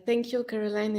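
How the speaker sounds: ordinary delivery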